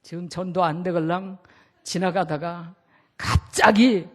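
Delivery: ordinary talk, nothing marked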